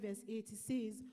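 normal talking voice

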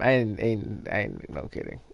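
ordinary delivery

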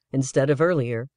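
plain reading voice

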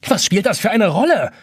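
high, irritated retort; getting angry. A narrator's reading. Jonas annoyed by the dome's question, getting angry. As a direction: fire back with rising irritation. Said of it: "Jonas wird langsam böse".

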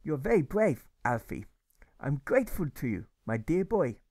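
put-on kindly grateful old German; German accent. Grocer thanks Alfie in a gentle German accent. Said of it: "the good German replies".

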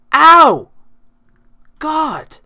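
real high, loud yelp of pain; pained yell. Real, not acted. Player hit in the game, sharp pained yell.

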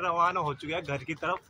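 normal talking voice

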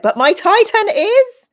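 loud, high, proud announcement; triumphant slow crescendo of pride; excited boast. Acted. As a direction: belt it out high, drawing it out. Acts out a chatter's proud boast.

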